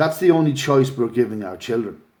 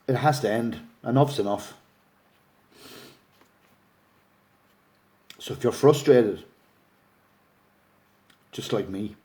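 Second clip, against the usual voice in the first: getting upset; 5 dB quieter than usual. Real, not acted. Campaigner appealing to frustrated supporters, near tears. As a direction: voice catching, real emotion held back. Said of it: "I'm getting upset".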